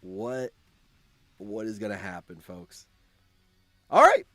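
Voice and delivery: ordinary talk, nothing marked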